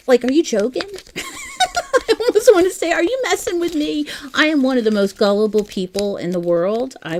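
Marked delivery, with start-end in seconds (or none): 0.1-4.1 high, loud, incredulous laughter; laughing disbelief. Real, not acted. Crafter asks a viewer if she's joking, laughing in disbelief. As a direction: laugh through the words, pitch shooting right up.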